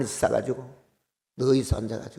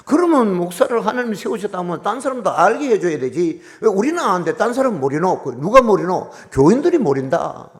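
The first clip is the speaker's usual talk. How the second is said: emphatic preaching; 6 semitones higher, 7 dB louder than usual. Real, not acted. Preaching rebuttal.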